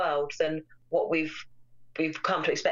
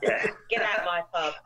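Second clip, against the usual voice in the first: East End pub landlord. Retold or imitated. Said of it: "East End type accent".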